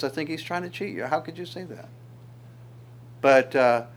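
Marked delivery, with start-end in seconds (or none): 0.0-1.9 quieter, quicker sly tempting voice; serpent's whisper. Acted. Serpent tempting the woman in the garden, quiet and quick. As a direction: hush it like a tempting snake. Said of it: "then the serpent says".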